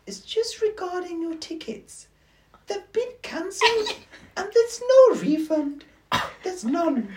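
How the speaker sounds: put-on high, slow, official girl's voice; fake girl's voice. Telling dad his tickets are cancelled, slow high girl voice. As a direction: deliver the bad news slowly in a high sweet voice. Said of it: "make it a girl's voice".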